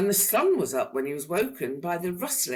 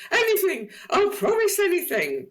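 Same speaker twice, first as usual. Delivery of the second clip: much higher, louder desperate plea; pleading dragon; 6 dB louder than usual. A narrator's reading. Dragon pleading with Mister Tickle to stop tickling. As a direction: beg with everything. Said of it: "pleaded the dragon".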